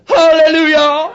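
high, loud, drawn-out hallelujah; mock exultant cry; joyful shout. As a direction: belt it out from the office. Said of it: "They hear it all the time".

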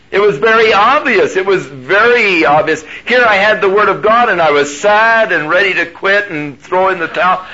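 high, loud, fervent sermon; preaching. Acted. Preacher to congregation, full-force preaching.

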